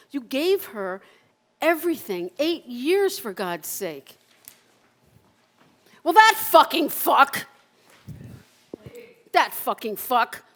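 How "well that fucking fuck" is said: high and loud, spat out; loud furious curse. Acted. Cursing the new boyfriend, loud furious curse. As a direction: burst with rage. Rage at ex's new man.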